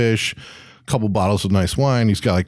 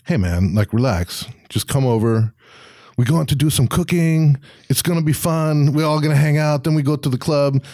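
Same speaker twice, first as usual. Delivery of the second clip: easygoing, higher-pitched French-ish voice; French-ish accent; 7 semitones higher than usual. Acted. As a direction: put on a loose French accent. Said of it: "what accent I'm doing right now".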